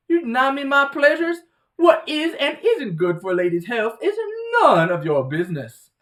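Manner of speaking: indignant Southern belle, loud and high; hammed-up offended Southern belle; put-on Southern accent, grandly affronted. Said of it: "sounds like a Southern belle when she talks".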